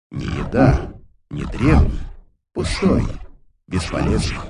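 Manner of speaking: low, soft eldritch horror whisper; hushed, almost tender, alien monster; quiet inhuman voice. Acted. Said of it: "нечеловеческий голос, на этот раз тихий, почти ласковый".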